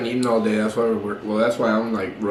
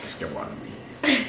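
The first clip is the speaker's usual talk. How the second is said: slow unvoiced whisper; slow whispered joke; 13 semitones higher, 7 dB quieter, much slower than usual. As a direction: drag it out in a whisper. Joking whisper.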